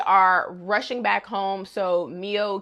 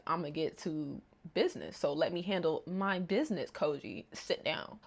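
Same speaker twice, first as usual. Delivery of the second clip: calm; 4 semitones lower, 13 dB quieter than usual. Retold or imitated. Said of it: "He's like".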